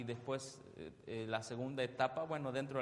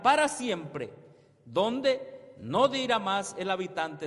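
full-force preaching; 6 semitones higher, 9 dB louder than usual. Acted. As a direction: preach it out to the congregation, stretching each word. Preacher proclaiming the quote. (predicación a toda voz)

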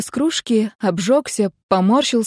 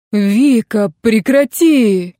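drawn-out groaning plea, louder; moaning plea; 5 dB louder, much slower than usual. A narrator's reading. Vitka begs Vika to stop, moaning. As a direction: moan it, begging her to stop. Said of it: "простонал он наконец".

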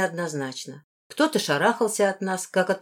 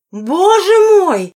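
shocked exclamation; 7 semitones higher, 9 dB louder than usual. A narrator's reading. A woman sees the girl's messy hair, exclaiming aghast. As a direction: exclaim in appalled disbelief. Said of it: "воскликнула она".

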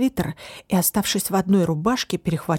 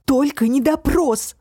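desperate cry; 7 semitones higher, 4 dB louder, slower than usual. Acted. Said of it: "отчаянно вскрикнула Алис".

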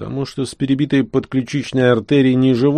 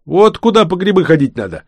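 high, loud and enthusiastic; excited exclamation; 9 semitones higher, 5 dB louder than usual. A narrator's reading. Vanya tells companions where to go mushrooming, excited exclamation. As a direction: call it out like a great find. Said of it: "воскликнул Ваня".

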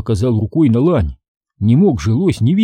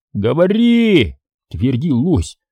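insistent demand; 6 semitones higher than usual. A narrator's reading. Moose pins Joe to the ground, insistent demand. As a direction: force a confession out of him.

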